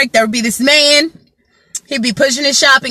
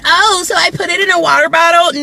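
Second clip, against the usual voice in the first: confused customer; 7 semitones higher, faster than usual. Retold or imitated. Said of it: "they're like".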